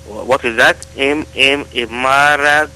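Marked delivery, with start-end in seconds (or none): none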